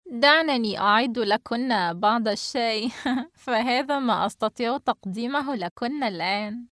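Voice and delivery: amused, with a laugh breaking in; still laughing. Acted. Hostess getting up to make tea, still laughing. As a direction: let laughter linger as you offer. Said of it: "وهي لا تزال تضحك".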